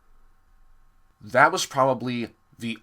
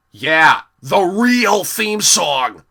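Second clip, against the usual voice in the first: mock cheer; 5 semitones higher, 8 dB louder, much slower than usual. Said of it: "everybody is going".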